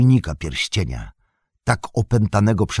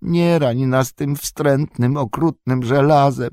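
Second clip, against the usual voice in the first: slow, high creature whine on "okrutnym żelazem"; whining Gollum; 7 semitones higher than usual. Acted. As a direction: plead like a pitiful wretched creature. Gollum pleads for his life.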